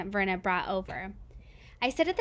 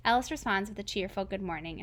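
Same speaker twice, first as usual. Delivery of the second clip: ordinary delivery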